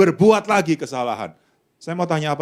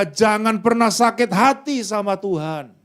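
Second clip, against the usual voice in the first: ordinary talk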